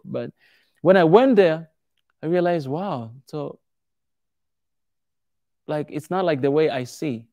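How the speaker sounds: ordinary talk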